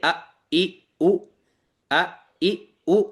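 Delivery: अ, ई, उ as brief one-beat vowels; teacher demonstrating short vowel length, one pulse each. Shown as a demonstration. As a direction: cut each of अ, ई, उ to a single beat. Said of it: "एकमात्रिक यानी एक अक्षर बोलते हैं".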